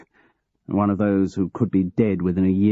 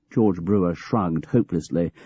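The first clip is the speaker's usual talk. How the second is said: ordinary delivery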